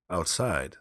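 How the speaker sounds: ordinary delivery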